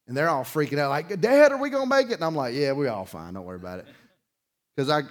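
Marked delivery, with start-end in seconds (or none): 1.2-2.1 put-on high, loud frightened child asking dad; mock scared child begging reassurance; panicked kid. Kids panicking in flood. 2.5-3.9 calm reassurance. Retold or imitated. Dad reassuring kids.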